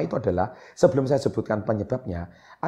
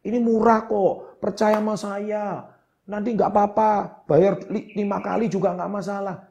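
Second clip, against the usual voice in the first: higher, slow, coaxing sales pitch; pushy salesman; 6 semitones higher than usual. Retold or imitated. Imitating a pushy seller pressuring a customer, high and coaxing. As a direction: lightly mimic a salesman pressing a reluctant buyer. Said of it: "Jangan paksa".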